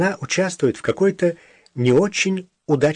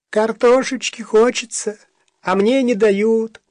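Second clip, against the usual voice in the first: put-on high, slow, pleading childlike whine; 9 semitones higher, 3 dB louder than usual. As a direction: beg like a hungry child. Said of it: "просящий голос".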